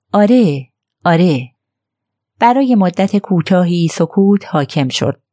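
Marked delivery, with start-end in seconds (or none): 0.1-1.5 understated, effortful agreement. A narrator's reading. Elinor answering Mary about the past, with effort. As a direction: bring each word out with some difficulty. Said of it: "الینور به زحمت گفت".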